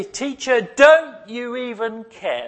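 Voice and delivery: high, slow desperate plea; pleading cry. Retold or imitated. Frightened disciples waking Jesus, slow high plea. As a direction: beg the teacher to care. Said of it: "they wake him up and they say".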